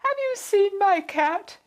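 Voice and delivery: high, loud and slow, full of eagerness; eager question. A narrator's reading. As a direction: ask as if everything hangs on it. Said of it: "she asked eagerly".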